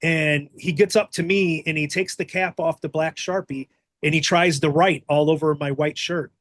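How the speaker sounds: usual speaking voice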